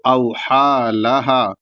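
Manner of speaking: very slow, last لہا held one extra alif; drawn-out recitation. Shown as a demonstration. Teacher modelling the phrase, very slow chant. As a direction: stretch the final vowel. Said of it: "اس طریقے سے".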